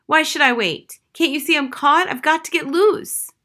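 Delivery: a trapped bird pleading to get free; young crow. Acted. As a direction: crow caught on the fence, scared and insistent.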